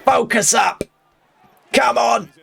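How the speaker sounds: high, slow shouted cornerman voice; imitating the cornerman. Cornerman yelling at his fighter between rounds, high mock shout. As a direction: bark like a desperate corner coach, drawn out and high. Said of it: "That's how you talk".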